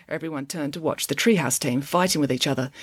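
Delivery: plain reading voice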